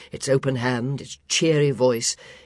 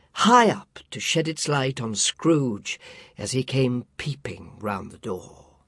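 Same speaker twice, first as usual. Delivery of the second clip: ordinary delivery, nothing marked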